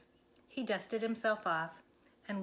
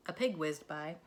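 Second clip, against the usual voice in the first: usual speaking voice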